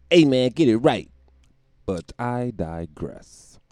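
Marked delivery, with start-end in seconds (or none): 0.1-1.1 [name] voice. Retold or imitated. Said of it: "I can hear him now".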